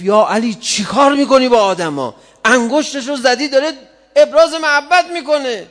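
high, loud accusing yell; angry shout. Retold or imitated. The enemy shouting at Ali over the servant, furious and loud. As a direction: hurl it at Ali as an outraged enemy. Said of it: "داد زد".

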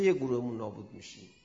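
ordinary talk, nothing marked

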